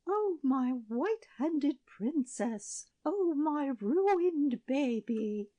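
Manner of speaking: high, slow lament; wailing. A narrator's reading. Queen wailing over her ruined baby. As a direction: mourn the ruined princess. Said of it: "shrieked the queen".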